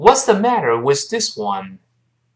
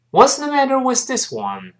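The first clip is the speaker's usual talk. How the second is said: quicker, linked-up model pitched much higher; faster reading; 10 semitones higher than usual. Shown as a demonstration. Teacher speeding up for learners to repeat, faster reading. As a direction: say it faster in one high run. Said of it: "我们快一点儿".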